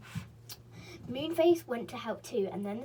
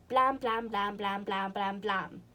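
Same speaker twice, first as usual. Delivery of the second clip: loud blams fading away; knocking blams; 4 dB louder, slower than usual. Acted. Silky knocking seven times, loud blams fading off. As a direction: bang them out, then let them die.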